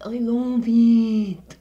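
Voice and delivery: shouting her love for the phone; loud emphatic declaration. Real, not acted. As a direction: belt it out emphatically.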